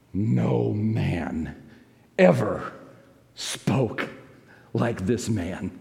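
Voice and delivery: low, soft, slow, each word set apart; awed answer. Retold or imitated. Officers answering the priests, hushed and awed. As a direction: speak it in hushed wonder.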